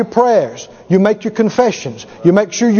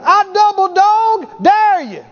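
higher, slow mock taunt; daring like Brother Hagin; 8 semitones higher, slower than usual. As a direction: dare the devil slowly. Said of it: "Like Brother Hagin".

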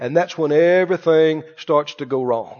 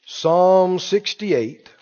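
slow announcing; much slower than usual. Real, not acted. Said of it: "Hold your place".

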